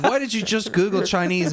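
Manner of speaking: high, incredulous question; amused disbelief. Real, not acted. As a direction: ask it in exasperated amazement. Exclaiming at co-host's silly search.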